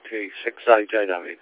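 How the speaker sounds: ordinary delivery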